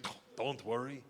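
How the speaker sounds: soft, unfazed Russian; quiet Russian-accented assurance. Acted. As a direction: reassure casually in a Russian accent. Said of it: "He's like".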